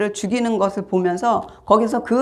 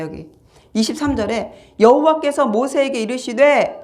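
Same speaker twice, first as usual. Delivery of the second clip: ordinary delivery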